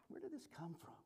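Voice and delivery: breathy hushed wondering; bewildered whisper. Retold or imitated. Couple realizing where the fight came from, whispering. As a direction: whisper it in disbelief. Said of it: "they would see that".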